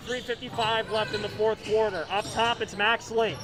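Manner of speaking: normal talking voice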